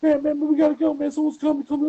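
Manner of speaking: high, loud rushed panic; hurried hang-up. Retold or imitated. [name] cutting the call short, high and rushed. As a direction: rush off the phone in a mock panic. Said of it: "And [name]'s like".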